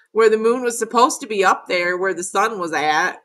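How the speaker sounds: usual speaking voice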